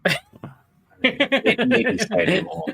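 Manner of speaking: high, laughing disbelief. Real, not acted. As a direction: crack up mid-sentence. Laughing at the tree story.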